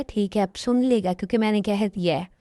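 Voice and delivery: ordinary talk, nothing marked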